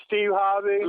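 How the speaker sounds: normal talking voice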